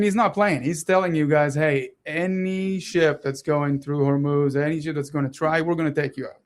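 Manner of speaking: ordinary delivery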